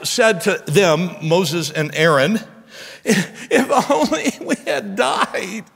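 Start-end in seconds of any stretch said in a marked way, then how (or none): 3.0-5.6 high, very slow mock moan; whining complaint. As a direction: drag it out in hammed-up self-pity. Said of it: "I kinda have to put tone to it".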